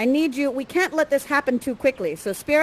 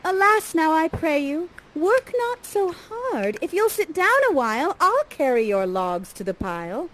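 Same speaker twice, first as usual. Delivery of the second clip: high, imploring maiden; Miranda's voice; 5 semitones higher than usual. Acted. Miranda begging Ferdy to rest, high imploring. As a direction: plead sweetly, pitched up, easing down at the end. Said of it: "and she says".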